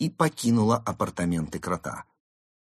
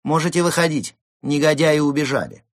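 high, clear, raised rescuer's voice; lovely female voice; 8 semitones higher, 7 dB louder than usual. Acted. Fox telling hidden Mole it's safe, high lovely voice. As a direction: call out higher and louder to the one in hiding. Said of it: "наипрекраснейший из голосов произнёс".

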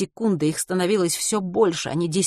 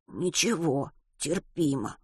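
much softer, holding back the pain; hushed, pained reassurance; quiet through pain; 7 dB quieter, slower than usual. A narrator's reading. Injured boy downplays pain.

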